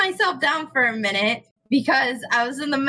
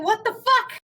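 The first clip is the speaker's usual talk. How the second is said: high-pitched mock scolding of a demon; indignant, high-pitched mock yell; retold yelling; 7 semitones higher than usual. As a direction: retell telling off your demon, pitched up. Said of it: "I was yelling at my demon".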